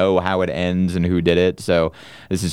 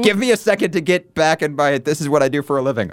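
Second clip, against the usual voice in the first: exasperated; 8 semitones higher than usual. Retold or imitated. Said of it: "I'm like".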